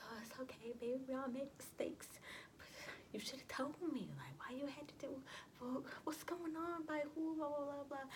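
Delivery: high-pitched mock mom voice; mimicking mom's high-voiced fussing; imitated mother. Imitates mother's reaction to news.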